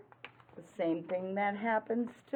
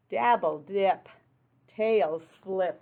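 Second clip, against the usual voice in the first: lively read-aloud rhyme; 6 dB louder, slower than usual. Acted. Reading rhyming picture book aloud.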